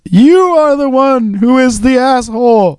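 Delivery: high, slow, drawn-out silly comeback; delayed comeback. Retold or imitated. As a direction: drag out the belated insult. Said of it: "Kevin Smith waited ... and went".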